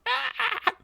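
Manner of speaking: very high, short screech; imitated monster shriek. Devouring toy answers the family, high imitated shriek. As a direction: mimic a creature's piercing cry. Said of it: "it's just going like".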